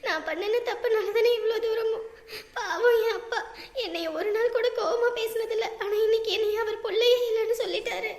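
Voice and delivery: sobbing, guilt-laden confession; tearful regret. Acted. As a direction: speak through sobs, blaming yourself. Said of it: "ஏமா இப்படி அழுதுகிட்டே கிடக்குற". (அழுதுகொண்டே வருந்திப் பேசுதல்)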